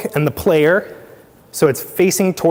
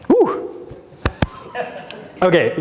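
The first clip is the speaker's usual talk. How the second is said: usual speaking voice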